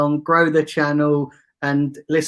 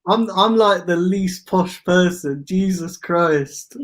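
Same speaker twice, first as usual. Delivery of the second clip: usual speaking voice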